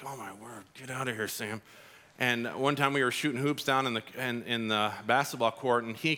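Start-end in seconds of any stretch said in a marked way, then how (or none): 0.0-1.6 much softer, fed-up muttering; annoyed mutter. Retold or imitated. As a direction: brush off the pest under your breath. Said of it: "he was just like".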